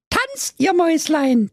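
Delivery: put-on high girl voice urging the mice; girl clapping and urging her mice; eager command. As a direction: call on the mice to dance.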